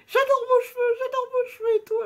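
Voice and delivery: very high, loud gushing voice; high excited voice. Retold or imitated. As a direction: gush it in a thrilled squeal. Stranger quoted complimenting.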